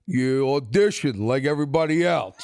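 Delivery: lightly voiced Stallone, flat and final; a hint of Stallone brushing off a kid; Stallone line. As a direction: drop it cool like a star closing the door. Stallone brushes him off at elevator.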